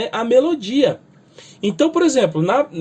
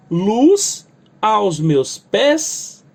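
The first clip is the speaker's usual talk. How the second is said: luz aos meus pés, slow, with luz and pés stressed; stressed hymn line; much slower than usual. Shown as a demonstration. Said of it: "Então eu tenho a palavra luz". (verso lento com acento em luz e pés)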